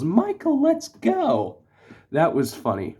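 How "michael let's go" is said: very high-pitched mock yell; imitated yell. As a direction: imitate her yelling in a much higher voice. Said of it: "Jackie comes in and yells".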